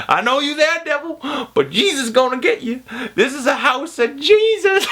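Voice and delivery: high, slow, falsetto preaching; hammed-up woman casting out the devil; mock holy defiance, drawn out; church lady rebuking the devil. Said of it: "Then she's like".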